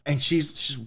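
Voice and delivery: usual speaking voice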